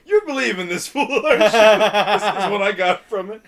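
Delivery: high, loud mocking taunt full of laughter; laughing taunt. Bobby mocks Jack for believing the fool, laughing. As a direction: needle him while cracking up. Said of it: "Bobby's just laughing at him".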